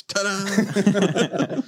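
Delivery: high, bright stage flourish; showman ta-da. Retold or imitated. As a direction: deliver it like a magician.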